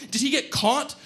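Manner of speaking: high-pitched anxious question; yelling. Acted. Narrator to friend about the missing accomplice, yelling. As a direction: fire it out high and alarmed. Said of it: "I yelled".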